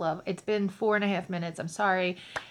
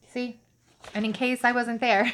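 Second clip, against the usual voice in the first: ordinary talk, nothing marked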